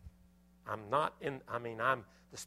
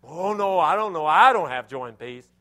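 mock despair; 4 semitones higher, 17 dB louder than usual. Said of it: "They say".

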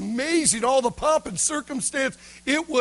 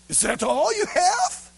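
demanding governor; 7 semitones higher than usual. Acted. Governor questioning the boy's single bean, demanding. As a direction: demand more, voice jumping up. Governor challenges the small gift.

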